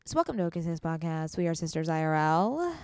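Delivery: low, hushed NPR host read; smooth public radio announcer. Real, not acted. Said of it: "Is that your, like, radio voice?".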